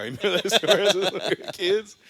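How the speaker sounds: high, loud laugh through words. Real, not acted. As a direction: laugh while asking. Laughing at shared memory.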